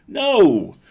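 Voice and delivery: high, loud protective shout; cry of no. A narrator's reading. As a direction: shout it to stop him cold. Protecting the gingerbread cookie.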